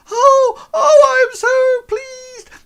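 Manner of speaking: ecstatic falsetto squeal, very loud; so excited. Acted. Erin hugging the orc who brought her game, excited squeal. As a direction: burst out like an overjoyed girl. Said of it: "And she's so excited".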